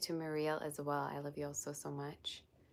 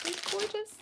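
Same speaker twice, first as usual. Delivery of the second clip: much higher, squealed in delight; thrilled squeal. Real, not acted. Streamer admiring the candle gifts, squealing with delight. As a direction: squeak it out like the gift is too pretty.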